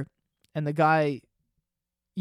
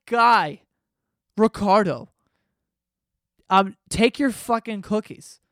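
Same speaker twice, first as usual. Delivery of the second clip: exasperated; 4 semitones higher, 5 dB louder, slower than usual. Retold or imitated. Arguing with Ricardo.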